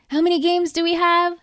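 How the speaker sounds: mock coach. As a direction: quiz the team like an eager coach. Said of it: "coach is like".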